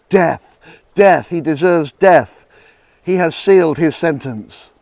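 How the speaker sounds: loud, slow cry of death; shouted verdict. Acted. Council condemning Jesus, loud shouted demand. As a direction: demand his death as a crowd.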